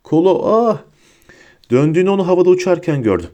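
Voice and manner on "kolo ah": short high yelp, cut off; high, startled shout; cry out. A narrator's reading. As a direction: cry out in sudden pain. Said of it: "Luna'nın bağırdığını duydu".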